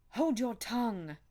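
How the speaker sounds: ferocious. A narrator's reading. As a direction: silence the maid fiercely, nerves jangled. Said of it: "with a ferocity of accent".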